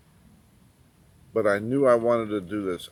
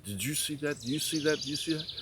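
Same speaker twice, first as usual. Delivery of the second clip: higher, rising amazed repeats to his wife; can't believe his eyes, pitched up; excited disbelief; 6 semitones higher, 4 dB quieter than usual. Retold or imitated. Telling his wife about the free tires, amazed and pitched up.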